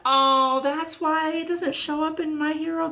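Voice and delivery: high, loud sudden realization; a sudden "oh!" of discovery; surprised. Real, not acted. Sudden realization about smoking.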